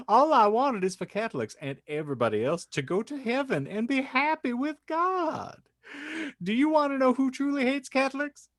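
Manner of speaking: high, sing-song devout mother; earnest church lady pleading her case; pious housewife. Acted. Host voicing the housewife answering her critics, high and pious.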